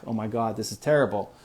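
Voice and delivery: ordinary talk, nothing marked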